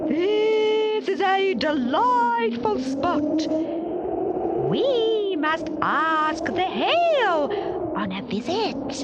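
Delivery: high, slow, howling wind voice; north wind. A narrator's reading. North wind delights in the frozen garden, howling slow and high. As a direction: let it howl out long and high.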